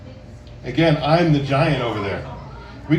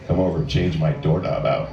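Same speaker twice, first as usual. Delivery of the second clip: much lower, laughing seductive "doorknob"; sexy tone; 12 semitones lower than usual. Shown as a demonstration. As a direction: drop deep and suggestive, half laughing. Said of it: "make it sound sexy by the tone of your voice".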